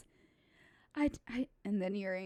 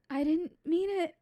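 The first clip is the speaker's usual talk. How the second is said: put-on upset pleading girl; 9 semitones higher, 8 dB louder than usual. Girl repeats apology, higher.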